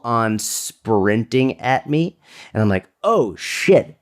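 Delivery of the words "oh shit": higher-pitched retold panic; mock alarm. Man seeing someone sprint at him, alarmed. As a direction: jump up in pitch on the realization.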